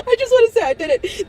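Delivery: high, tear-choked repeat of the wish; crying repeat. Real, not acted. Telling viewers she wants a degree, crying. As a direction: say it again, voice breaking with tears.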